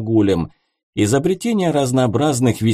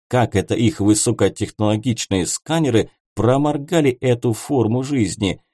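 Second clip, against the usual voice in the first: ordinary delivery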